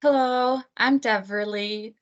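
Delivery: ordinary talk, nothing marked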